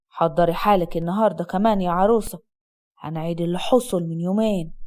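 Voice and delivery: low, menacing husband; sharp. Acted. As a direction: threaten in a low-pitched, controlled voice. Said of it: "وقال بحدة". (بحدة وتهديد بصوت واطي)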